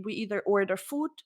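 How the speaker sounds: ordinary delivery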